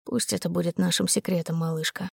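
soft, low confidential murmur; murmured whisper. A narrator's reading. Lover whispers back about their shared secret, softly murmured. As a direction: share a secret under the voice, sinking at the end. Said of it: "шепнул Влад в ответ".

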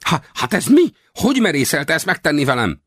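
high, stammering indignation; outraged. Acted. Bound westerner demanding how he dared, high and indignant. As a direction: splutter in high-pitched outrage.

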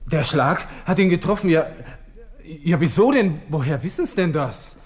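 high, pressing, barely hiding alarm; agitated questioning. Acted. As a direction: fire the questions in nervous excitement. Presses waiter about the news.

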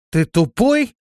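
high, loud, outraged question; sharp incredulous outrage; indignant. A narrator's reading. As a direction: snap at him in indignation. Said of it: "спросила возмущённо Листи".

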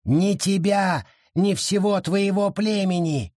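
venomous forest creature; forest spirit's threat. A narrator's reading. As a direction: curse the whole tribe. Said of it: "процедил лес".

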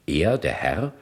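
usual speaking voice

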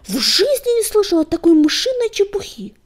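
loud, high indignant scolding; angry scolding. A narrator's reading. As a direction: tear into her, outraged. Old healer keeps scolding angrily.

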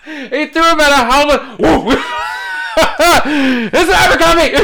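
high-pitched words breaking into real laughter; laughing. Real, not acted. Riffing on clothes thrown at a homeless person, high and laughing. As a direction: crack up at your own joke while telling it. Cracking up over own joke.

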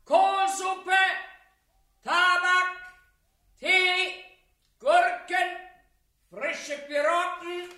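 high, loud market cry; vendor's call. Acted. Mother calling her wares at the gate, loud market cry. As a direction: sing out the wares to buyers.

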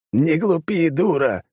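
high angry shout; losing temper. Acted. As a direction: lose it and yell at her. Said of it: "вышел из себя латиноамериканец".

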